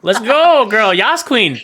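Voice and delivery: loud, high laughing cheer; cheering hype. Real, not acted. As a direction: hype her up like a fan.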